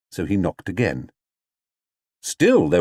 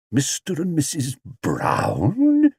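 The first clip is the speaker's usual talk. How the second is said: slow, higher bewildered echo; dazed; 6 semitones higher, slower than usual. Acted. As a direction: repeat it stunned. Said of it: "in a dazed voice".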